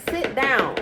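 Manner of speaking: ordering someone at home to sit down, a firm real command. Real, not acted. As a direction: tell them to sit, firmly. Commanding someone mid-recording.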